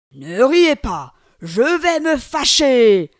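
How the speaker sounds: very loud, slow monster growl; slow booming threat at the laughing crowd; angry demon. Acted. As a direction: bellow the warning. Demon warns laughing villagers.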